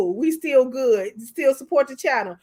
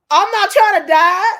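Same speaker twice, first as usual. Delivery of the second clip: loud, high-pitched protest; emphatic refusal; 6 semitones higher, 9 dB louder than usual. Acted. Host refusing to fight over a lover, loud and high. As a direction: raise your voice sharply in refusal.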